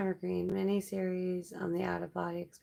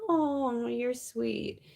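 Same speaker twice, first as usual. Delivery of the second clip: condescending adult; 5 semitones higher, 4 dB louder, much slower than usual. Retold or imitated. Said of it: "pat me on the head and be like".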